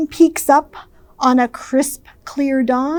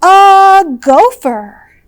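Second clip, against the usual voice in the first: bright announce; 6 semitones higher, 8 dB louder, slower than usual. A narrator's reading. New guest introduced.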